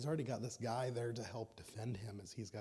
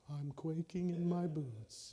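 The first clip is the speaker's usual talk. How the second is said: higher, slow, mocking sarcasm; sarcastic Goliath; 6 semitones higher, 5 dB louder, slower than usual. Goliath mocking David's claim, slow and sarcastic. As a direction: drawl the fake fear with a sneer. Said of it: "just whoop-de-doo".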